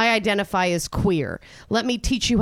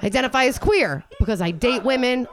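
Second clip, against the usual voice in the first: ordinary delivery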